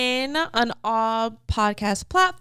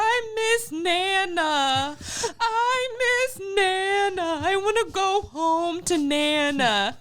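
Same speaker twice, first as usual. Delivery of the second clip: put-on higher, slow, whiny crying kid voice; imitating a crying child; 7 semitones higher, slower than usual. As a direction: act out a little boy sobbing for his grandma, slowly. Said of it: "I hear Parker crying".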